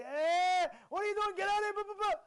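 high, loud heckling outburst; hammed-up angry telling-off; mock scolding. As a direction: shout them out, acting outraged. Acts out the wrong way to rebuke.

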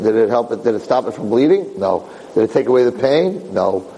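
usual speaking voice

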